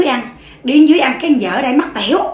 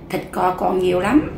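hushed reply; 6 semitones lower, 6 dB quieter than usual. Acted. Wife answering husband at night.

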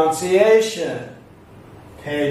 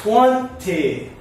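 first syllable of Twenty raised, second falling; stress on TWENty; 2 semitones higher than usual. Shown as a demonstration. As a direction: hit TWEN, then let ty drop. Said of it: "The stress goes up".

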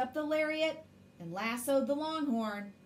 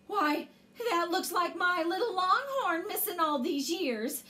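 Ma's amazed gasp, pitched up; gasping surprise; 5 semitones higher than usual. A narrator's reading. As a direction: exclaim it in higher-pitched astonishment. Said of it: "Ma gasped".

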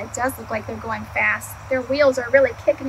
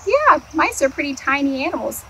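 plain reading voice

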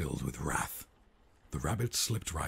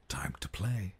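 whispered line; 3 semitones higher than usual. Acted. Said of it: "the prince said".

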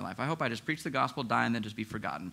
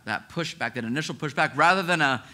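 normal talking voice

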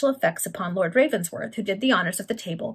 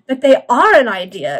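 loud, higher indignant protest; angry exclamation; 4 semitones higher, 11 dB louder than usual. A narrator's reading. Baron contradicts the bishop, angry and loud. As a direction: burst out crossly in contradiction. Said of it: "exclaimed the baron angrily".

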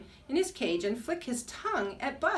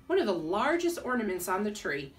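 plain reading voice

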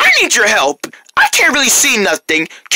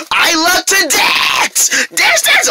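excited squeal; 7 semitones higher, slower than usual. Acted. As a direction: squeal about dancing. Kalani freaking out.

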